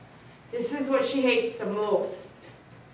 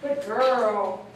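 normal talking voice

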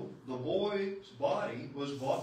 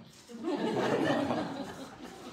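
laugh; 12 semitones higher, 5 dB louder than usual. Acted. Maiden laughs before agreeing.

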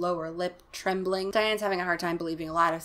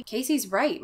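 ordinary delivery, nothing marked

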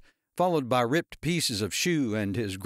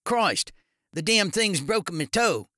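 pained yell; 8 semitones higher, 4 dB louder than usual. A narrator's reading. Darren in pain from broken toe.

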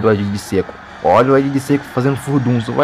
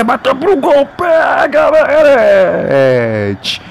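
sudden shot call; 11 semitones higher, 3 dB louder, much slower than usual. Real, not acted.

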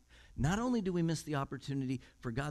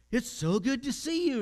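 eager greeting; 9 semitones higher, 4 dB louder than usual. Retold or imitated. Billy greets him warmly.

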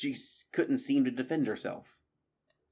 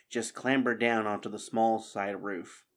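ordinary delivery

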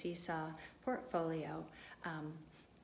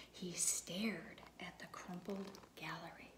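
slow, hushed wonder; awed whisper; 3 semitones lower, 6 dB quieter, slower than usual. A narrator's reading. Teacher reading the moment of wonder, slow whisper. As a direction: whisper it like seeing something amazing. Awe at sister's wall.

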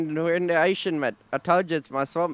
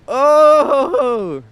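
excited yell; 9 semitones higher, 12 dB louder than usual. Real, not acted. Stunt lands.

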